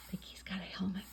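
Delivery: soft, breathless whisper; whispered aside. Real, not acted. Reader whispers to the children about the helmet.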